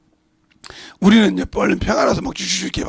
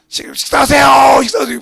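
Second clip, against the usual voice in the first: loud, higher mock call to dinner; shouted call; 6 semitones higher, 9 dB louder than usual. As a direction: yell the meal call though he's right beside. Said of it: "바로 옆에 가서 그러니까".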